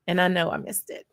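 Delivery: normal talking voice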